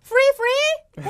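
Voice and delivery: high, loud, hopeful question; eager asking. Acted. Korean tourist abroad asking about freebies, high and eager. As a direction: pounce on the freebie. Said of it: "외국 나가면 맨날 물어보는 거".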